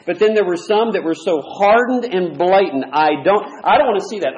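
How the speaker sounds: loud, driving pulpit delivery; forceful preaching. Acted. Preacher warning his congregation, full-force preaching.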